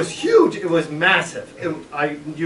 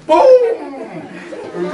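higher, louder boom; boom sound effect; 10 semitones higher, 6 dB louder than usual. Retold or imitated. Storyteller marking the frog landing, loud boom. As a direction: punch the landing.

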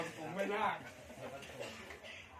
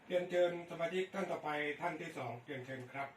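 over walkie talkie; 4 semitones higher, much faster than usual. Real, not acted. Radio net call over the air.

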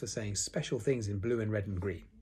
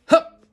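sudden loud little "hup"; accidental hiccup; 18 dB louder than usual. A narrator's reading. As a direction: let a sharp hiccup pop out. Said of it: "said Roo accidentally".

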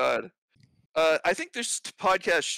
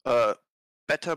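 ordinary delivery

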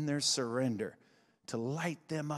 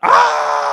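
high loud scream; 16 dB louder than usual. Real, not acted. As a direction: scream out, full voice. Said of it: "me screaming right now".

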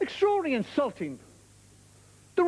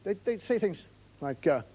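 ordinary talk, nothing marked

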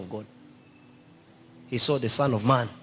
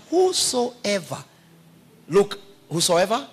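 preaching emphasis; 5 semitones higher, 6 dB louder, slower than usual. Real, not acted. Preacher driving home key word.

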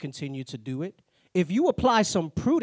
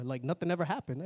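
normal talking voice

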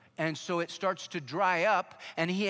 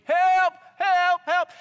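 high, loud panicked yells; screaming for help; 13 semitones higher, 11 dB louder, slower than usual. Retold or imitated. Agent fleeing the bull, screaming high and loud. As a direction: shout it like a bull is chasing you. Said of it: "he hears that D E A agent screaming".

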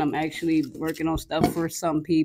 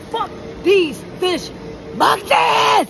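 frustrated shout; 13 semitones higher, much slower than usual. Real, not acted. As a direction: yell it out in frustration. Frustrated outburst at work.

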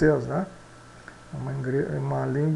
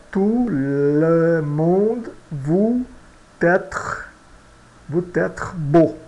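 slow French example; much slower than usual. Shown as a demonstration. Teacher reading the example sentence slowly to students. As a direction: Tout le monde vous être beau said very slowly. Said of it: "Vamos ver o nosso exemplo".

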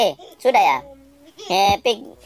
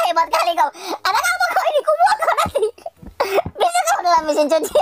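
very high squealing laughter through the words; laughing disbelief. Real, not acted. Gamer finds the baby in the washing machine, shrieking with laughter.

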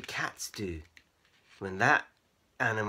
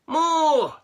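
high, loud demand; excited cry; 13 dB louder than usual. A narrator's reading. Cats begging Keith for magic, excited cry. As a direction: shout it eagerly. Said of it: "cried excitedly".

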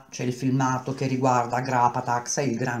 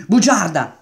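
loud, higher snap of an accusation; furious loud cry; angry accusation; 6 semitones higher, 9 dB louder than usual. A narrator's reading. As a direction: throw the word at her. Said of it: "disse Atarva".

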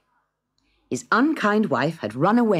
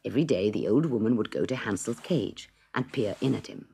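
usual speaking voice

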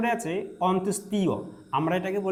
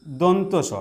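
usual speaking voice

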